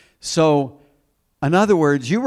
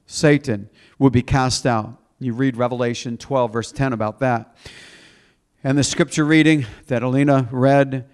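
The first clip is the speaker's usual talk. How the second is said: ordinary delivery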